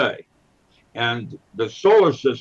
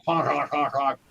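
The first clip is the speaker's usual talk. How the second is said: clown horn honks; Clarabelle honks; 3 dB quieter than usual. Retold or imitated. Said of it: "programmed with Clarabelle".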